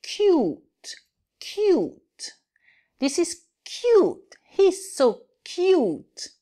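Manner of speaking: high, slow, breathy coo over something adorable; cooing cute. Acted. Teacher acting out cooing at a cute baby for students.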